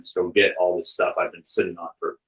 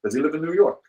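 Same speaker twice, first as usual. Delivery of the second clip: higher-pitched imitated Russian accent; 6 semitones higher, faster than usual. Russian friend asks where the bootlegger lives, mock Russian accent. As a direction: mimic a prying Russian friend. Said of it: "that same Russian friend".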